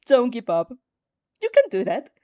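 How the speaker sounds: self-encouragement. Acted.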